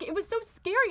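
ordinary delivery

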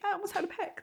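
a high, soft fake panic; mock panic. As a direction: squeak it out as if about to panic.

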